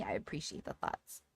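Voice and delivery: soft whispered aside. Real, not acted. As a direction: breathe it quietly to chat. Unvoiced aside after gushing.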